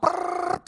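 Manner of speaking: high rolled-tongue machine-gun burst; imitated gunfire. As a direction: trill out a mock volley of shots. Imitates gunfire aimed at barista. (tiruan bunyi tembakan beruntun)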